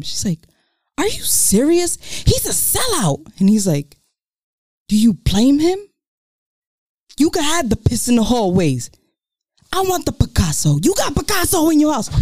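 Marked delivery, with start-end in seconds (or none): 1.0-3.2 high-pitched, incredulous outburst; acted indignation. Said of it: "Let me channel my inner, uh, Emmy Award winning performance". 4.9-12.1 high, hammed-up accusation; acted argument. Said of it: "Let me channel my inner, uh, Emmy Award winning performance".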